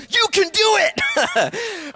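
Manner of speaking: high, drawn-out shouted cheer; over-the-top comic shouted encouragement; hammed-up catchphrase impression. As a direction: belt it out slow and high. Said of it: "Tony Robbins is the".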